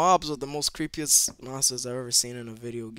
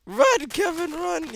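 high, loud, frantic urging; urgent shout; 5 dB louder, slower than usual. Real, not acted. Gamer urges himself to run from monsters, panicked shout. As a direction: yell it as you bolt away.

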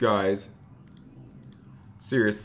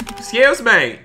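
loud high call; 9 semitones higher, 7 dB louder than usual. Acted. Banker shouting after the fleeing thief, loud call.

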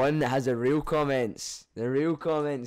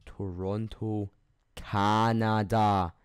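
Toronto and Canada drawn out, Canada punched louder; slow, spelled-out correction; 4 dB louder, much slower than usual. Shown as a demonstration. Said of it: "Not America".

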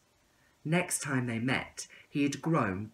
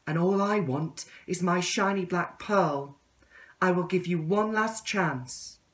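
higher, earnest request; caterpillar voice; 7 semitones higher, 3 dB louder than usual. Acted. Caterpillar asking the tadpole to promise, higher. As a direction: ask him for the promise again. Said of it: "said the caterpillar".

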